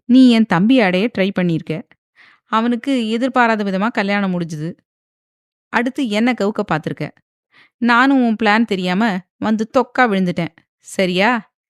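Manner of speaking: loud, heated accusation; a man enraged at his betrayer; furious. Acted. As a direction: hurl the blame at her in a rage. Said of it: "Saravanan ஆவேசமாக சொல்லவும்".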